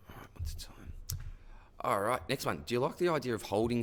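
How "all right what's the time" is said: whispered aside. Real, not acted. Off-mic aside checking the time.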